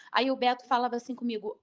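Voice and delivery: ordinary talk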